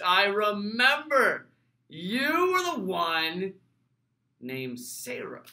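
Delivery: slow, drawn-out mock remembering; mock-dramatic recollection. Host feigning he recalls commenter Sarah, slow mock drama. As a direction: stretch it out as a grand recollection.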